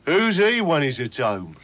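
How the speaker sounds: slow, sneery East Midlands put-down; dismissive local. Retold or imitated. Lightly mimicking dismissive townsfolk, slow East Midlands sneer. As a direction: drag it out dismissively. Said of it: "they say".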